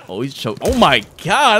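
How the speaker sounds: ordinary talk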